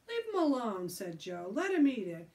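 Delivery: loud, high defensive. Acted. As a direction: stand up for him loudly. Joe defending loudly.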